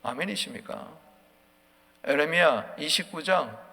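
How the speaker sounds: ordinary talk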